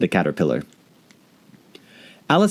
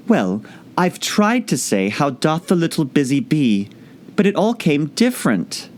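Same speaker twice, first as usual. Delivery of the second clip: melancholy; 7 semitones higher than usual. A narrator's reading. Alice explaining to the caterpillar, melancholy. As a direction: sigh it out sadly. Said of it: "in a very melancholy voice".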